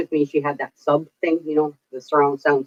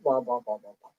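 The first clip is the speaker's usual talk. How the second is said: low, soft, fading drone; quiet monotone; 7 semitones lower, 12 dB quieter than usual. Shown as a demonstration. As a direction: drone it out under your breath. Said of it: "I'm monotone. I'm quiet".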